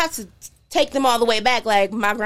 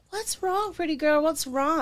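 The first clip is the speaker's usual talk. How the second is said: put-on tender older lady; 8 semitones higher than usual. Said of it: "and she was like".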